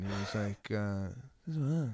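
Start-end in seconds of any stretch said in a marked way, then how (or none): 1.4-1.9 higher-pitched, mumbled De Niro impression; unintelligible mumble. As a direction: mumble it so the waiter can't understand. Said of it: "I couldn't understand what he was saying".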